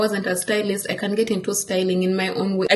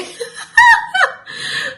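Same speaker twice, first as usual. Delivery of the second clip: high burst of laughter; 6 dB louder than usual. Real, not acted. Laughing at emailing God.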